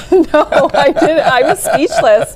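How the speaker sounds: high, loud laughing protest; helpless laugh running through the words. Real, not acted. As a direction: protest through real laughter, pitched well up. Laughing at teasing question.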